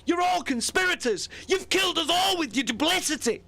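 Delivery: high frantic accusing shout. Acted. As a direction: rage at them in panic. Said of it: "he shouted".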